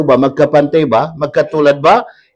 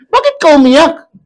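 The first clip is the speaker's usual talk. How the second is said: mother asking; 12 semitones higher, 4 dB louder than usual. Retold or imitated. Mother asks crying father.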